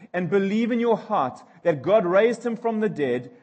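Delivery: ordinary delivery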